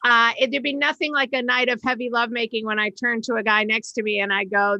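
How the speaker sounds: normal talking voice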